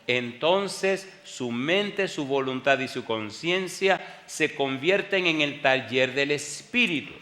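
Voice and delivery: usual speaking voice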